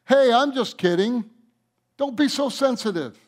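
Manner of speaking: playful mocking voice of the devil; mock Satan. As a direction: brush off the hurt like a sly tempter. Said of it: "Satan lies to us".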